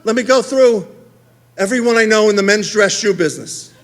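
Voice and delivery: normal talking voice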